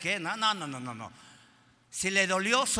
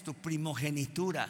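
ordinary talk

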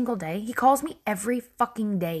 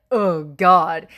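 mock groan; 4 semitones lower, 5 dB louder than usual. Mimics her own lazy reluctance.

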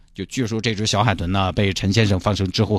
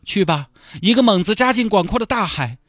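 high, hammed-up sentimental goodbye to the dolphin; tearful farewell; 13 semitones higher than usual. [name] bidding the released dolphin farewell, hammed-up sentimental. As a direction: send the dolphin off like your own child, overplayed.

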